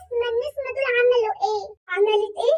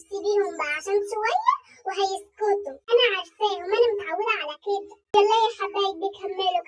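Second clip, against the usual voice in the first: normal talking voice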